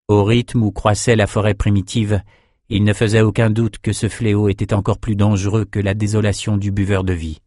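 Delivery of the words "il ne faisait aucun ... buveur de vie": ordinary delivery, nothing marked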